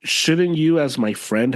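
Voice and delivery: ordinary talk, nothing marked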